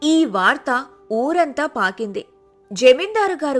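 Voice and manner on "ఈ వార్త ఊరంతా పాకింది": ordinary delivery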